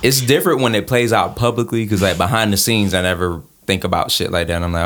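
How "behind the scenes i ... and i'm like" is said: ordinary talk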